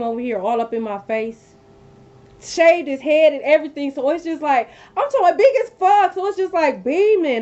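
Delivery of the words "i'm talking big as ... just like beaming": higher and louder, exclaiming in disbelief; worked up. Real, not acted. Telling viewers about the huge hickey, excited and loud. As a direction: pitch it up, can't believe the size.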